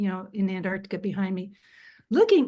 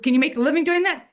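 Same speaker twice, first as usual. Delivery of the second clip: quick, raised mock challenge; crusty Brit; 6 semitones higher, 6 dB louder, faster than usual. Repeating the crusty Brit's affront, mildly imitated challenge. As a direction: lightly mimic an affronting question, louder and faster. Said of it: "a bit of an affront".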